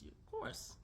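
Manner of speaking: a squeaky mocking whisper; sarcastic high breathy echo. Repeated sarcastic aside.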